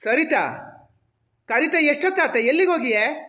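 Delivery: high, impatient call across the house. Acted. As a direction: call again, annoyed at the wait. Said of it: "ಎಂತಕ್ಕೆ ಆ ಥರ ಕೂಗ್ತಿದ್ದೀರಿ".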